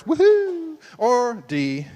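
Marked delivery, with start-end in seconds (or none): 0.0-0.8 high, louder woohoo; mock cheer. Pastor recapping the fireworks option, high cheering whoop.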